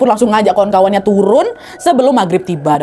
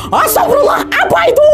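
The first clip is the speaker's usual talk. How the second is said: high-pitched startled cry; panicked yell. Acted. Arif on the peak breaking the calm, panicked yell. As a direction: yell out in sudden alarm, pitch shooting up. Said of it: "pada saat Arif teriak".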